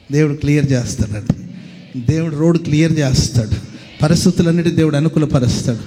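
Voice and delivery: loud, driving sermon climax; full-force preaching. Acted. Preacher promising God clears the road, loud preaching. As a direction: proclaim it to the whole church.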